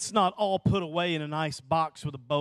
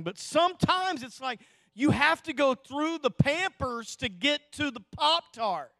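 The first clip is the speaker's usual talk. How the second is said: full-force preaching; 10 semitones higher than usual. Acted. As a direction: build the sermon point with fervor. Preaches life principle.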